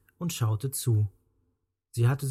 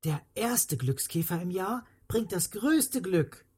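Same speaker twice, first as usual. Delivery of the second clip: higher-pitched, happy cry; delighted exclamation; 6 semitones higher than usual. A narrator's reading. Injured girl spotting the year's first ladybird, delighted exclamation. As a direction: exclaim it like an overjoyed child. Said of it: "rief sie".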